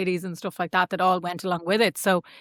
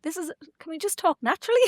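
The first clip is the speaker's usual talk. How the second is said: exasperated plea; 9 semitones higher, 3 dB quieter, faster than usual. Retold or imitated. Exasperated host retold.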